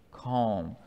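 soft echo of the first Calm; hushed calm. Shown as a demonstration. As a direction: let Calm settle down into a hush. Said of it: "and there was a great what?".

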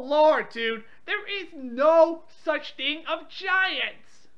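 normal talking voice

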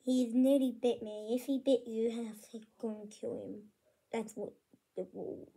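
ordinary delivery

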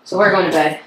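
ordinary talk, nothing marked

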